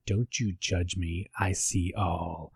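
low, soft menacing phone voice; imitating the creepy caller, low and soft. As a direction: loom over them in a low hush. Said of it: "he said something like".

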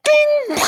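sharp high ding sound; ding like a toaster. Pretend. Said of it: "perform for me the sound".

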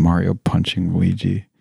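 ordinary talk

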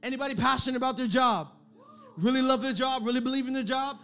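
normal talking voice

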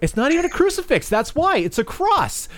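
high-pitched mock rant, shouting at the end; mock tantrum. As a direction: fake outrage, rising to a yelled insult. Mock outburst at Connor.

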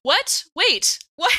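high, stunned disbelief; shocked. Real, not acted. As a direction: squeak out the surprise. Player shocked at the death.

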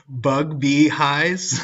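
bug bee highs, slow and drawn out; misreading big thighs. Retold or imitated. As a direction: read "bug bee highs" slowly as three words. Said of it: "I think it's big thighs".

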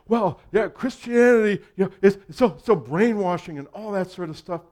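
sneering skeptic, hammed up; mocking scoff. Mocking the world's claim.

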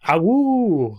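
high, drawn-out wolf howl. Pretend. Players howling like wolves.

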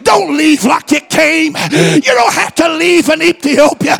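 high, loud preaching exhortation; shouted altar call. Real, not acted. Preacher calling people to lift hands, shouted altar call. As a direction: urge the congregation on at full voice.

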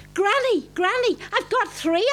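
high excited call; excited shout. A narrator's reading. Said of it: "I shouted".

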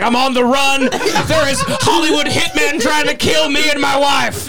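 high, laughing mock [name]; hammed-up paranoid [name]. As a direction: play a frantic fugitive, laughing. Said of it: "and he's like".

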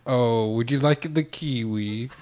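slow New Zealand attempt gone Jamaican; New Zealand accent. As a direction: try Kiwi, land in Jamaica. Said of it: "Oh, we went back to Jamaica".

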